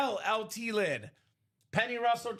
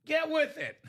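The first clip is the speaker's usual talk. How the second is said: exasperated scolding; 8 semitones higher, 3 dB louder than usual. Retold or imitated. Scolding aunt, retold.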